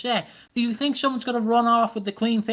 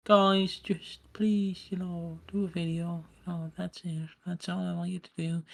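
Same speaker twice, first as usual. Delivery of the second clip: limp mock plea; 4 semitones lower, 8 dB quieter than usual. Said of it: "what can you do with EastEnders".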